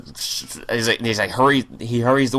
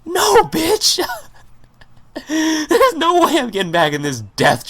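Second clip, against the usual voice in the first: mock terrified shriek. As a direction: shriek it as a joke, refusing to get back in. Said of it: "I'm like".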